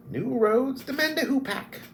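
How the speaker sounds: high, bright-eyed kender chant. Acted. Narrator voicing the kender saying, high and eager.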